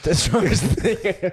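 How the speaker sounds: amused echo breaking into laughs; cracking up mid-phrase; laughing. Real, not acted. Listener laughs repeating the quote.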